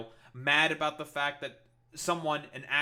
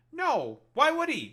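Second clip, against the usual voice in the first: high, loud, emphatic disbelief; exasperated, high-pitched rhetorical comeback; incredulous; 12 semitones higher, 8 dB louder than usual. Real, not acted. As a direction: shoot the idea down, pitch jumping up. Answers own question about Powell, exasperated.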